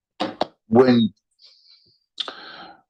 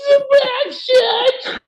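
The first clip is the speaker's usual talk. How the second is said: very high shrieking panic; hammy horror scream. Acted. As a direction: scream like a movie detective. Parody Se7en scream.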